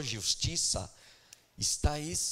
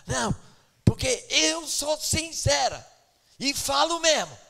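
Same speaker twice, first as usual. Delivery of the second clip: mock indignant retort; 10 semitones higher, 7 dB louder than usual. Sister retorting to pastor.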